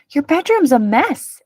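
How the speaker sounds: put-on high, loud child's exclamation; exclaiming. A narrator's reading. Marta reacting to Pearl's cluttered room, exclaiming. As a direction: blurt it out in dismay. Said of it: "exclaimed Marta".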